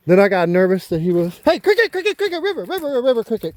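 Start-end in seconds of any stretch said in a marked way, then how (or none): 1.4-3.6 high-pitched, rapid-fire calling of dogs' names; real urgent high call to pets. Real, not acted. Urgently calling dogs back.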